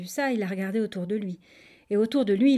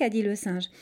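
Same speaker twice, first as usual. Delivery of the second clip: plain reading voice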